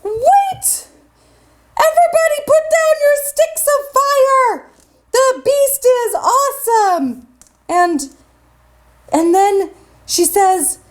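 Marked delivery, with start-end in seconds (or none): 0.0-7.3 high-pitched cry, no real volume; mock scream. A narrator's reading. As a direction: call out to halt the crowd. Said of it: "she screams out".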